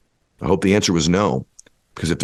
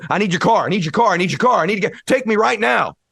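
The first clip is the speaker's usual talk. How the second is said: urgent begging. Retold or imitated. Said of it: "You'd ask".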